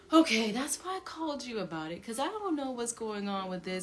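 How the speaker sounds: heated reply. Retold or imitated.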